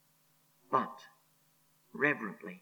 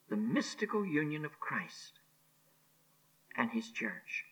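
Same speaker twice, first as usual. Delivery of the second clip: hushed repeat; 10 dB quieter than usual. Real, not acted. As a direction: let it sink to a whisper. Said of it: "I repeat".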